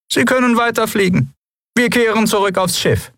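high clipped order; curt official. A narrator's reading. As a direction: dismiss them briskly. Officer ordering his men. (hohe, knappe Anweisung)

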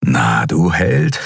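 slow, malicious taunt from an inner voice; bösartige Stimme. A narrator's reading. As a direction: sneer it slowly at a would-be hero. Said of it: "sagte eine bösartige Stimme". (bösartig und höhnisch, langsam)

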